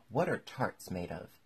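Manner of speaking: plain reading voice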